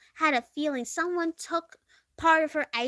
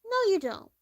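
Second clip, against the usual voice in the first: ordinary delivery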